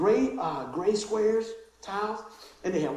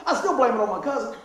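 ordinary talk

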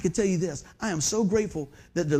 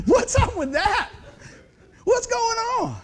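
high, slow laughing disbelief; baffled; 14 semitones higher, 4 dB louder, slower than usual. Retold or imitated. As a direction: act amazed at the silly prize. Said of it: "I'm going".

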